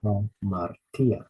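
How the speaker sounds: teacher modelling the rough breathing in Hamartia; slow pronunciation. Shown as a demonstration. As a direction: stretch Hamartia syllable by syllable, breathing the h. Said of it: "we add a sound at the beginning".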